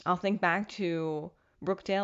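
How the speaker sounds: ordinary talk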